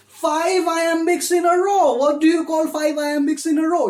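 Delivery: high, loud burst of enthusiasm; excited quizzing. Real, not acted. Teacher asking students about five iambics, excited and loud. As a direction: raise it high and loud, rousing the class.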